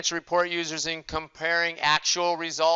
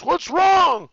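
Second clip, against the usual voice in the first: put-on high, louder worried old man's question; imitating old dad; 7 dB louder than usual. Elderly father asks his yelling son, high and worried. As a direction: play the eighty-nine-year-old father, high and concerned. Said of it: "My poor dad, who's eighty-nine, goes".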